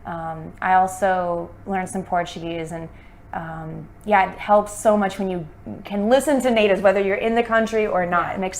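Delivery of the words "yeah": usual speaking voice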